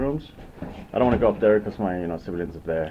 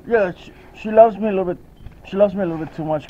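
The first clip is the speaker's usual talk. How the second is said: excited; 9 semitones higher, 6 dB louder, faster than usual. Real, not acted. Dog jumping on her.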